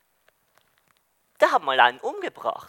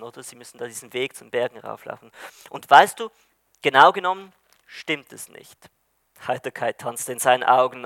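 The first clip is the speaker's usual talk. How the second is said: ordinary delivery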